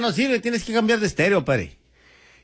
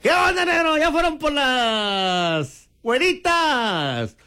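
excited greeting; 7 semitones higher, slower than usual. Real, not acted. Host hails a friend on air.

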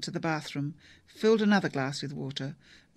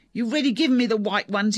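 loud, higher angry protest; narrated shout; 5 semitones higher, 8 dB louder than usual. A narrator's reading. Father refusing pills from his daughter, loud shout. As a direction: shout it at her over the pills. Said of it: "he shouted".

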